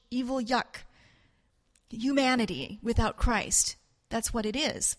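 ordinary talk

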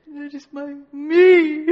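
hammed-up loud wail, drawn out; mock bawling in self-pity; crying out loud. Believer crying over their own failures, mock loud wail. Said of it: "are you crying out loud".

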